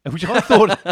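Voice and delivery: high, loud burst of laughter through the words; laughing. Real, not acted. Laughing at the hotel story.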